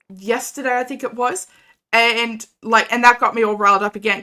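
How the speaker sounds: usual speaking voice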